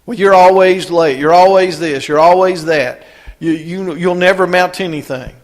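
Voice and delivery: a critical dad running down his kid, in a mock scolding voice; nagging dad. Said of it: "dads that are always looking for everything wrong".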